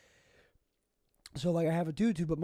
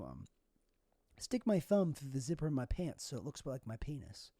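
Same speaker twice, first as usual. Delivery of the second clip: ordinary delivery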